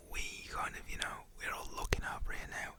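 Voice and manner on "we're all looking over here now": ordinary delivery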